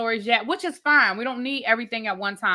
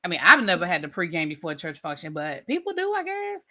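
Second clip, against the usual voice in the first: normal talking voice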